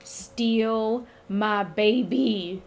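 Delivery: slow, drawn-out mock outrage; pissed-off mother. Demeter confronts them over her stolen daughter, slow indignant anger. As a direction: stretch each word with indignant weight. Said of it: "Demeter is fucking hella pissed".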